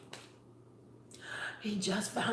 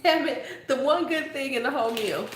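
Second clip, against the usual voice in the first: mock annoyed; 5 semitones higher than usual. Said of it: "I was like".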